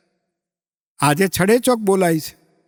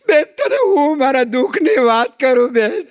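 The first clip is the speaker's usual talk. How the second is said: hammed-up tearful wail, higher; mock crying; 5 semitones higher than usual. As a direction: fake weeping over her sorrows. Mock tearful lament about husband. (બનાવટી રડતો અવાજ)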